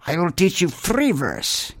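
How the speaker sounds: put-on slow, stern nun in a Russian accent; Russian accent, lightly done; mock Russian accent. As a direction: announce it like a Russian teacher. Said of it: "I don't know any of their accent".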